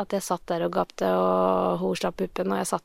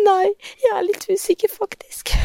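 put-on high, breathy whisper of a small child; sheepish child owning up; little girl's voice; 12 semitones higher than usual. Four-year-old admits to her mother she's lost, sheepish whisper. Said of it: "sier hun".

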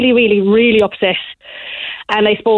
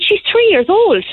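higher, slower, exasperated retort; mild mock outrage, higher and slower; cross retort; 10 semitones higher than usual. Mother to shop manager, exasperated and cross. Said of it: "but I was so cross".

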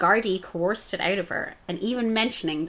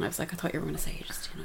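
whispered aside; 10 dB quieter, much faster than usual. Real, not acted.

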